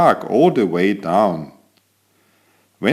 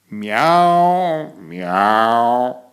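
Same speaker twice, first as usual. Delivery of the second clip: cat meowing. Acted. Cat narrator testing his voice with a meow. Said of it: "Then I tested my voice".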